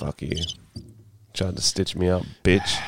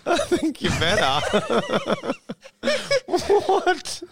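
high squealing laugh through the words; cracking up, voice jumping up; slower than usual. Real, not acted. Laughing hard on set story.

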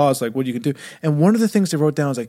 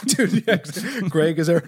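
normal talking voice

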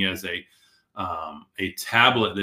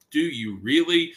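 doubtful, raised-eyebrow question; sceptical; 6 semitones higher, 5 dB louder than usual. Retold or imitated. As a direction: ask it like you doubt them. Sceptical reply to contact seekers.

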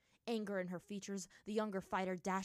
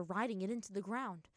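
ordinary delivery

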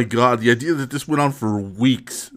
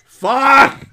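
high yelled curse; frustrated shout; 13 semitones higher, 4 dB louder than usual. Retold or imitated. Frustrated outburst after call.

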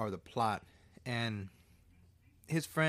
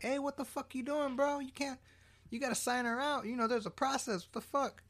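much higher, indignant mock protest; mock outraged hospital staff; 14 semitones higher, 4 dB quieter than usual. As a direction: play the scandalized staff member, hammed-up. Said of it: "Nobody's like".

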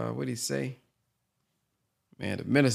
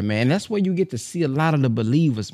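usual speaking voice

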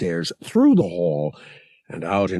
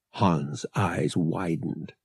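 plain reading voice

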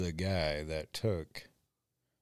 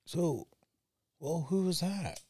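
imitating coworker; 10 semitones higher than usual. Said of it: "she goes".

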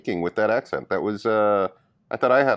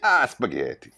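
put-on Italian accent; slower than usual. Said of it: "Italian accents".

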